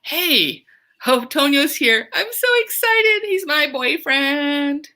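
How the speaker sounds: put-on high, gushing girlfriend, stretching "He's my boyfriend"; teacher as Marie gushing about Tonio, very high; excited Marie. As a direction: squeal it like a girl in love. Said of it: "Marie is probably feeling".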